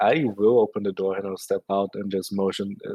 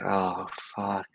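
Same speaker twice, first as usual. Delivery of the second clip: low, softer weary sigh; deep sigh; 3 semitones lower, 6 dB quieter than usual. Acted. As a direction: sigh it out in exasperation. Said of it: "She's gonna take a deep sigh".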